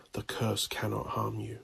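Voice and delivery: ordinary delivery, nothing marked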